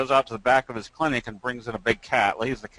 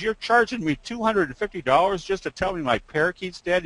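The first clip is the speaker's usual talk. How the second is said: higher-pitched mock outrage; indignant customer; 7 semitones higher than usual. Parakeet owner challenges the vet's bill in mock indignation.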